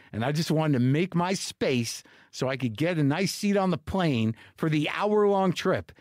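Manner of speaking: ordinary delivery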